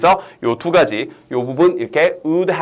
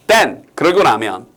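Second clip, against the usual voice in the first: ordinary delivery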